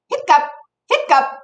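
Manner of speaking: high double hiccup; hiccup sounds. Acted. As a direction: squeak out two hiccups. Pete hiccups in story.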